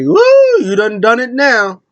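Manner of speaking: very high, loud woo, then teasing; hollering woo. Retold or imitated. Judgment Day taunting from the back, loud whoop. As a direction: whoop like it's about to go down.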